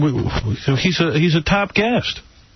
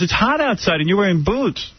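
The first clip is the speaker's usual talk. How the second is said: higher-pitched imitation Romanian accent; mocking Romanian accent; 6 semitones higher than usual. Woman questioning his boots, mock Romanian accent. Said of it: "I hate that accent anyway".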